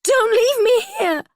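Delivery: high, loud panicked scream; desperate scream. A narrator's reading. Abandoned prisoner screams after Holly, terrified. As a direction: scream it as she walks away. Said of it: "I scream".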